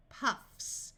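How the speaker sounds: ordinary delivery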